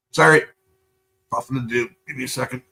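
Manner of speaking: normal talking voice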